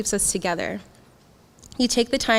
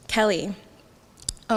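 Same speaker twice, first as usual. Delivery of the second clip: ordinary talk, nothing marked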